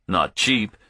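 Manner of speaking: plain reading voice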